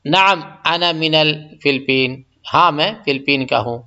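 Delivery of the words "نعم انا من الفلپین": slow, careful Arabic answer; slow model answer. Shown as a demonstration. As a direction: say it slowly so learners can copy. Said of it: "یعنی".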